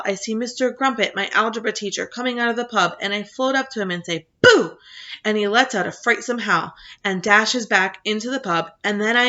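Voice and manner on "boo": sudden high, loud boo to spook; playful boo. Retold or imitated. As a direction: jump out at the teacher with a big boo. Boy spooking his teacher.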